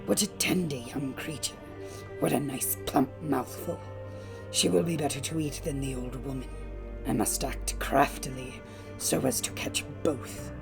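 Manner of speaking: low, soft, hungry wolf musing; quiet, deep-pitched predator's aside; scheming wolf. A narrator's reading. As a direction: plot to yourself, hushed and low, savoring it. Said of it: "The wolf thought to himself".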